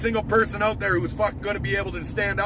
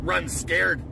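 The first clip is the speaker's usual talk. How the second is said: usual speaking voice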